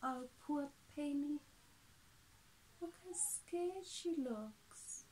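ordinary delivery, nothing marked

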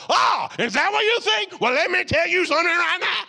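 hammed-up shouting back at an accuser; mock angry shout. Shown as a demonstration. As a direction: fire right back, escalating. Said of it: "somebody brings fire at you".

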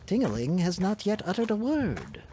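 worried brother. A narrator's reading. Brother worries about the princess, lightly voiced worry. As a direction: fret over the silent princess. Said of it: "said Triltril".

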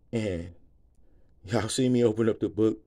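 ordinary delivery

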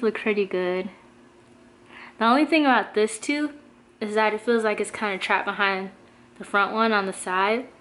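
lip pulled down by finger; talking while showing teeth. Real, not acted. Seen 0: Fingertip pulls lower lip down showing braces; briefly released at 2.2.

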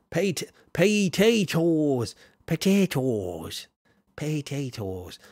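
drawn-out, wobbly Irish "potatoes"; slow, hammed-up Irish brogue; attempted Irish accent. Shown as a demonstration. Streamer trying the word for chat, shaky attempt at an Irish accent. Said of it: "say that in the Irish accent".

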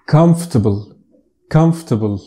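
comfortable said "KUMF-tuh-bul", three syllables; comfortable squeezed short; correct English pronunciation. Shown as a demonstration. Teacher modelling comfortable the short way. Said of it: "مش comfortable".

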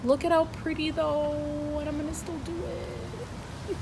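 high, soft coo; silly cooing voice. Real, not acted. Defying husband's complaint about her voice, soft high cooing. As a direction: coo it softly and high, unrepentant. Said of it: "Why do you have to do that with your voice?".

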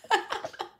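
high breathy laugh; a short real laugh. Real, not acted. Groomer laughing over the wig in the trash.

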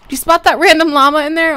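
loud, delighted call to the viewers; pointing out a surprise find; excited. Real, not acted. Excited gamer to viewers, spotting a llama, loud.

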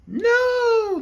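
high, bright chorus of toy bears. Acted. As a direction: answer together as little bears. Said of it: "they answered in a chorus".

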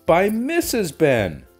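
plain reading voice

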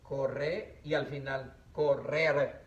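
Corre y al final correr slowly, rolled rr; slow pronunciation demo; slow trilled rr. Shown as a demonstration. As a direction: draw out each rolled r. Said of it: "aquí está el sonido fuerte".

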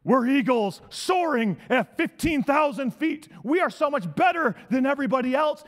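usual speaking voice

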